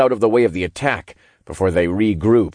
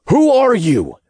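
high, loud demand; raised voice, demanding an answer; 6 dB louder than usual. A narrator's reading. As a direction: fire the question out forcefully. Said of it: "I demanded".

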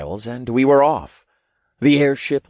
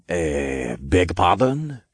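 put-on French accent; 3 semitones lower, slower than usual. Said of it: "thick French accent".

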